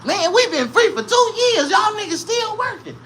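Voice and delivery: high mocking taunt; freed slaves taunting. Freed slaves taunting the working slaves, high and mocking. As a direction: rub their freedom in. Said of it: "they was telling them".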